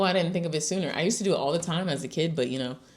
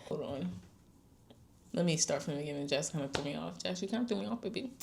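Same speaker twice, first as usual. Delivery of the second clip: much softer, turned away to someone off-mic; quiet aside; 10 dB quieter, much faster than usual. Real, not acted. Aside to Jess who interrupted, much softer. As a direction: drop the volume, talking to Jess nearby.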